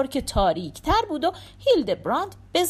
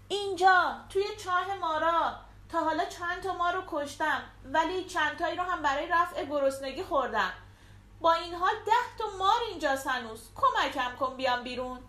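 higher, softer voice from down a well; lightly voiced trapped king, half joking; Dietrich in the pit; 6 semitones higher, 4 dB quieter than usual. A narrator's reading. Dietrich answers Hildebrand from the snake pit, a touch higher and softer.

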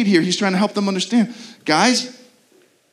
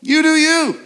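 careless dismissal; 7 semitones higher, 4 dB louder than usual. Retold or imitated. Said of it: "the most cruel thing our Savior could do is say".

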